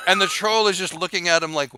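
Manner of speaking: ordinary talk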